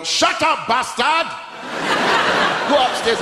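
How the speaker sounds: hammed-up dad yell; mock angry shout. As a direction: snap it at the kid like a furious dad. Mum yells at child.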